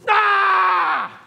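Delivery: sudden loud high yell of pure frustration; frustrated scream. Retold or imitated. Pastor reenacting his scream at a stuck pallet jack, loud frustrated yell. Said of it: "I just screamed".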